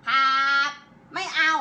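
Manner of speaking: loud, forceful 'ครับ'; demonstrating a rude, forceful yes; slammed, snappish reply. Shown as a demonstration. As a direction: slam the word ครับ out hard. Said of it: "ห้ามครับแบบนี้".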